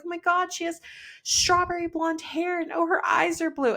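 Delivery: high, gushing coo over a newborn. Retold or imitated. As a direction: coo like a delighted nurse. Staff cooing over newborn.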